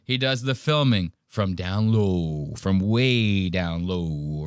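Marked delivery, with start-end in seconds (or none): 1.3-4.4 slow, low drop on the drawn-out lows; voice sinking low. Shown as a demonstration. Describing low camera angles, voice sinking. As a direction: stretch it downward as you say it.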